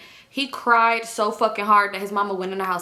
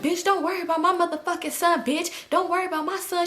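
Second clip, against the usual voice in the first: quarrelling retort; 7 semitones higher than usual. Retold or imitated. Acting out Jolene arguing back.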